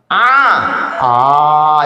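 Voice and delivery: loud, emphatic "ah" for 'ain; 'ain drill. Shown as a demonstration. Teacher drills the 'ain for student, forceful "ah". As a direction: push out "ah" strongly, twice.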